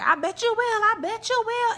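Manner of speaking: high, teasing taunt; mock taunt. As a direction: taunt him, high and sly. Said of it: "Kiki was like".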